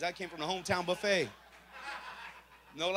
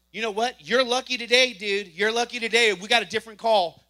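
brusque cop; 3 semitones higher, 10 dB louder than usual. Retold or imitated. Officer dismissing the driver, loud brusque. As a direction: tell him he's lucky, loud and quick. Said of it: "she goes".

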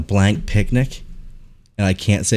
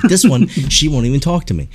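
mock yell, raised pitch; retold yelling; 6 semitones higher, 3 dB louder than usual. Older man griping about the tall girl, mock yelling. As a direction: imitate a man loudly complaining. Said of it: "like, yelling at me".

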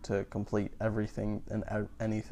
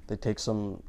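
usual speaking voice

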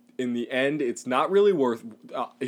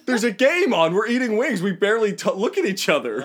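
exasperated retort; 6 semitones higher than usual. Retold or imitated.

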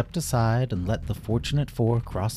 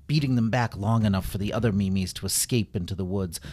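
ordinary delivery

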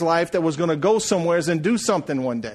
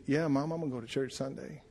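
soft reply; 11 dB quieter than usual. Retold or imitated. Answering dying mother softly.

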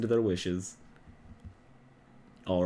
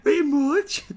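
put-on girl voice; 7 dB louder, slower than usual. Mimicking the girl voice.

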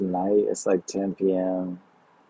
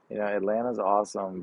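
ordinary talk, nothing marked

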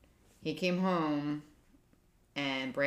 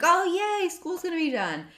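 high, loud mock cheer imitating a child; excited kid cheer; 7 dB louder than usual. Son cheering that school is ending, excited.

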